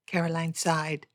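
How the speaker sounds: ordinary delivery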